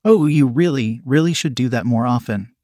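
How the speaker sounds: plain reading voice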